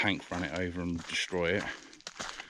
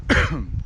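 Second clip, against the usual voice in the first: a loud sudden cough; 3 semitones higher, 11 dB louder than usual. Real, not acted. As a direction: cough into the mic. Cough mid-sentence.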